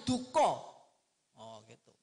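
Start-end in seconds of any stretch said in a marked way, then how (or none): none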